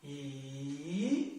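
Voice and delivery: E dipping very low then rising; third tone E. Shown as a demonstration. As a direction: sink E down and lift it. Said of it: "Third tone".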